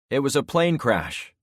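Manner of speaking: loud call across the distance; shouting. A narrator's reading. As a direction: shout it ahead to strangers. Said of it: "I shouted".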